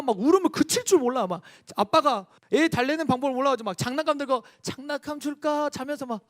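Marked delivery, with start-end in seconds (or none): 4.6-5.7 put-on coaxing baby. Father coaxing crying baby.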